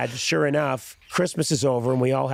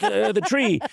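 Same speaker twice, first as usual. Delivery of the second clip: put-on earnest kid; 9 semitones higher than usual. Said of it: "We'd be like".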